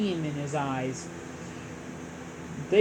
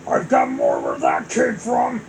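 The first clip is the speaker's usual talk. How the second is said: very low, louder growl; 6 dB louder than usual. Acted. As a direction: growl it like a monster. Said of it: "Bowser growled".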